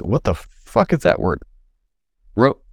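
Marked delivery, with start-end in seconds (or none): none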